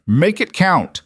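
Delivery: loud, high shout; shouting over gunfire. A narrator's reading. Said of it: "Caleb shouted over the gunfire".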